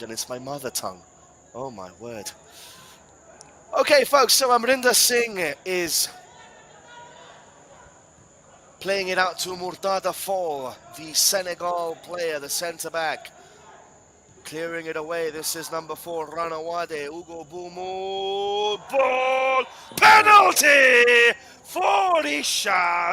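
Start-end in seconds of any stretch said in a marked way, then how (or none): 3.8-18.8 higher, louder, building commentary call; live play-by-play. Acted. 18.9-23.1 much higher, louder, slow drawn-out shout; commentator shout. Real, not acted. Penalty called live.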